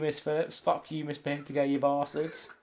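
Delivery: normal talking voice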